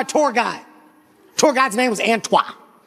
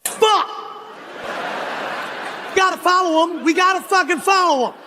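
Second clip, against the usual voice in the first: exasperated cry; 5 semitones higher than usual. Retold or imitated. Guide runs off, exasperated group follows.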